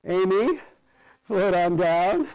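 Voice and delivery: bright, lifted playful aside. Real, not acted. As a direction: tease the class a little.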